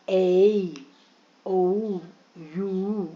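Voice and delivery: ए, ओ, यू each stretched out and separated; long vowel sounds. Shown as a demonstration. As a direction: hold ए, ओ, यू long for learners. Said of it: "long vowel sounds हैं इनकी".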